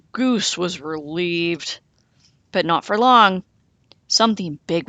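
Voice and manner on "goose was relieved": plain reading voice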